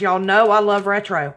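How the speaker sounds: ordinary talk, nothing marked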